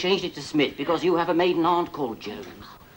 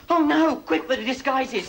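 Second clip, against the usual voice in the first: high, hurried alarm; mock panic; 8 semitones higher, slower than usual. Lover hears the husband is coming, mock panic. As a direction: blurt it out in a comic panic.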